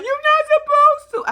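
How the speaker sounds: high, loud whiny mimicry; mock whiny plea. Vlogger mimicking naive Eve's protest, high and whiny.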